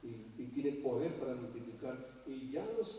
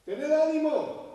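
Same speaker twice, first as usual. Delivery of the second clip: loud, slow, ringing call to take courage; 12 semitones higher, 10 dB louder, slower than usual. Acted. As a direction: call out to them over the storm. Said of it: "les habló diciendo". (llamada fuerte y lenta: "Tened ánimo")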